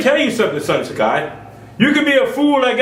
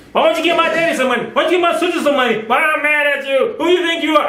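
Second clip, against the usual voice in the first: nagging relative; 6 semitones higher, faster than usual. Acted. Voicing greedy demanding relatives.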